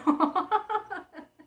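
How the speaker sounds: high, falling real laugh; burst of laughter. Real, not acted. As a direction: laugh out loud at your own joke. Laughs at her own joke. (carcajada aguda y espontánea)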